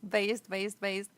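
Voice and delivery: a short, loud, delighted call after laughing; gleeful cheer. Real, not acted. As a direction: cheer it out, amused and approving. Laughing at a chat headline.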